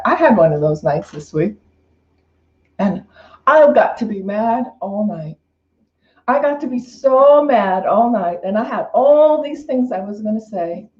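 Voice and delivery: ordinary talk, nothing marked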